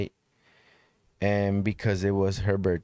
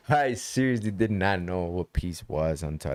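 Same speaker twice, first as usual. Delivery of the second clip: ordinary delivery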